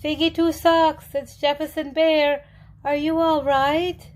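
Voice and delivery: a bear calling anxiously outside a den. A narrator's reading. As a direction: call into the den, worried. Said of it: "He went to her den and called".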